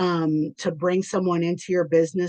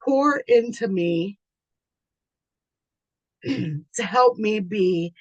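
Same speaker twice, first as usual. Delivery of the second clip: choking up; 3 semitones higher, slower than usual. Real, not acted. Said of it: "I'm already getting emotional".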